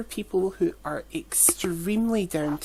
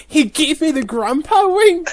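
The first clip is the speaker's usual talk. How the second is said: high, loud, laughing retold squeal; giddy excitement; 9 semitones higher, 7 dB louder than usual. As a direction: blurt it out to your cousin, thrilled. Excitedly telling cousin, retold.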